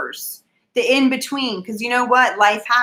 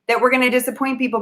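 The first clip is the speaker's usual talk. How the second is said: ordinary talk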